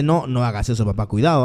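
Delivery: low voice of conscience; low, imitated word of caution; cautioning inner voice. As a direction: drop low to warn like an inner voice. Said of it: "mi capacidad me dice".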